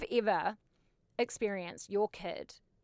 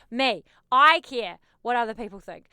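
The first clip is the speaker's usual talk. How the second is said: louder, punching the I; emphatic retort; 10 dB louder than usual. Acted. Answering an imagined critic, loud and emphatic. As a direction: firmly own it, I care.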